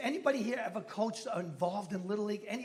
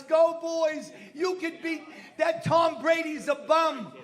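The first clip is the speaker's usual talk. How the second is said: loud, high fan shout; rowdy cheering; 5 semitones higher, 7 dB louder, slower than usual. Retold or imitated. Uber driver cheering on Dallas at the game, rowdy shouting. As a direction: holler for the team from the stands. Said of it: "He was cheering on Dallas".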